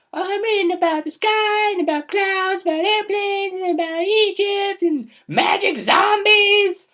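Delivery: put-on very high, loud squealing boy; excited kid. Little boy telling grandma what he reads, high loud squeal. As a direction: shout the list like a thrilled little boy. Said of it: "he's like".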